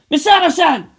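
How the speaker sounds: loud high urgent call. Acted. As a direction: call out over the line. Shinji calls urgently.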